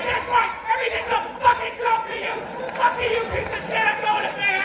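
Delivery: very high, loud mock screech; shrieking impression of an enraged wife; wife going ballistic. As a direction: scream it like a furious wife. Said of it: "she just went ballistic".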